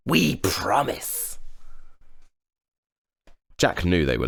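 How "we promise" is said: high, slow fake promise; sly goblins. A narrator's reading. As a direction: promise sweetly while lying, sly goblins. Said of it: "giggling and nudging each other".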